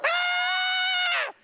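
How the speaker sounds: a sudden shrill "Ah!" way up high; high girlish scream. Retold or imitated. Pastor reenacting his scream after the shooting, high shriek. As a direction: shriek like a little girl in pain. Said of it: "I screamed like a little girl".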